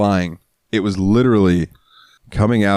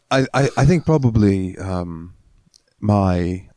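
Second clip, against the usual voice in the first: normal talking voice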